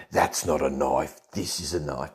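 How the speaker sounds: badly faked Australian film line; bad Australian accent. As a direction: have a rough go at Australian. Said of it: "I can't do an Australian accent".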